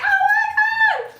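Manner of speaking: very high, loud, slow shriek; replayed panicked shriek; terrified scream. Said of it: "And I'm screaming".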